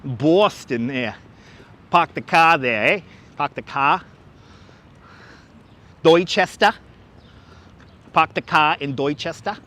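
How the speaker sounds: playful Boston accent, dropping the R's. Shown as a demonstration. Livestreamer showing a viewer his attempted Boston accent. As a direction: put on a Boston accent drifting into Canadian. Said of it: "Can I speak with a Boston accent?".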